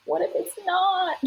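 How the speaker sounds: whiny, high and pleading. Retold or imitated. To her midwives, a whiny worried plea. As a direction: whine it out like a worried mother in labour. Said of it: "like, a whiny".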